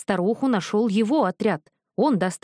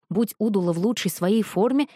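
ordinary delivery, nothing marked

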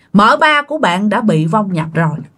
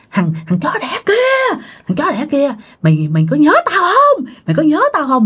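higher-pitched scary confrontation; menacing curse, acted; 4 semitones higher than usual. Possessed aunt confronting the man who pushed her, menacing. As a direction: challenge him like a vengeful spirit. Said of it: "Mà làm thấy ghê lắm nha".

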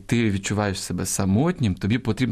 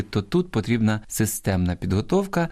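ordinary delivery

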